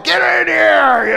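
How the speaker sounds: slow hammed-up yell; mock shout. Kid impatient for his spanking, hammed-up loud yell. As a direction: bellow it out, drawn out and loud.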